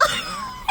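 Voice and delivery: high wailing laugh-cry. Real, not acted. Vlogger recalling Gangle scene, laugh-crying. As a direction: break into a squeaky sobbing laugh. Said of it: "the tears just came out".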